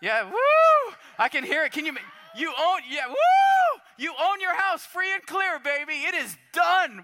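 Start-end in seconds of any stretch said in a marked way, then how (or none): 0.0-0.9 high, loud woo of delight; pretend cheer. Imagined mortgage forgiven. 2.3-7.0 high, loud joyful shouting; excited shout. Pretend. Imagined mortgage forgiven.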